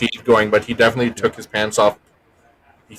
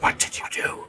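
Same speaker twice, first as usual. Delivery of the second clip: hushed whispered question; whispering; 10 dB quieter than usual. Real, not acted. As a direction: ask in a secret whisper. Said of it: "Why are we whispering?".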